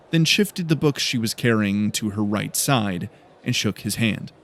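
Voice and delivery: ordinary delivery, nothing marked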